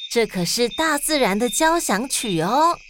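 ordinary delivery, nothing marked

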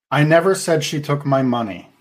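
"never" hit hardest, falling after it; stress on never. Shown as a demonstration. Demoing emphasis for podcast hosts, stressing "never". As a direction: lean on "never", the rest follows it down. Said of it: "different meaning depending on which word you emphasize".

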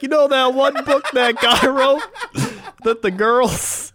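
high, loud mock voice breaking into laughter; mocking imitation. Teasing co-host about that one book, high laughing mockery. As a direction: mimic your co-host's rambling, laughing as you go. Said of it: "You're like".

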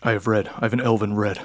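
lightly voiced imposing host, slightly low; understated, measured villain offering wine. Villain offers a guest wine, understated low voice. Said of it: "and he goes".

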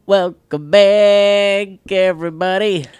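slow, loud, high welcome; announcer welcome. Acted. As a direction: boom it like a show host. Host's big show welcome.

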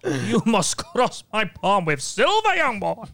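fortune teller. Pretend. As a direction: demand payment like a mysterious seer. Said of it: "give us a little tease of your character".

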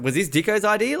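normal talking voice

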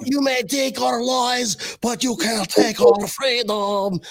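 drawn-out Braveheart battle cry in a bad Scottish accent; high, slow, hammed-up Scottish rallying cry; horrible Scottish accent. Host mimics Braveheart to open the show, horrible Scottish accent. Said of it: "my Scottish accent".

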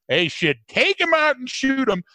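high-pitched interviewee blurting an opinion; street-guy impression. Slim answering the TV camera, high mock outburst. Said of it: "And he goes".

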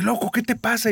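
high, exasperated snap; impatient call. Retold or imitated. Girl snapping at her frozen boyfriend, high impatient call. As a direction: jolt him out of it.

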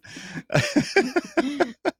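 big helpless laugh at the joke; real laughter. Real, not acted. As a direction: crack up for real, high and breaking.

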